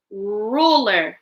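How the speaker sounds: "ruler" with a long, held R at the start; drawn-out first sound. Shown as a demonstration. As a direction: stretch the opening R of "ruler". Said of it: "Listen to the beginning sound".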